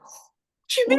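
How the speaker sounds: high, loud amazed little voice; astonished child. Retold or imitated. Daughter amazed at mum's offer, high loud surprise. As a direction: squeal it in disbelief. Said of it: "She was like".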